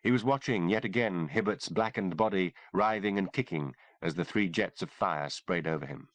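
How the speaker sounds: ordinary delivery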